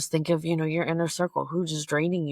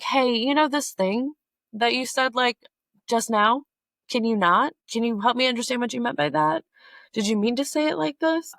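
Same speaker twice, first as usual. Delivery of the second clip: much higher-pitched, questioning call-out; confronting on the spot; 8 semitones higher, 4 dB louder than usual. Retold or imitated. Speaker asking a friend what they just meant, confronting. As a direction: act out raising it right away, pitched up.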